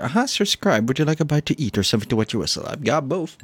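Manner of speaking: quick, lightly acted offer to a guest; lightly voiced innkeeper. Innkeeper offers the scribe a bite, lightly voiced.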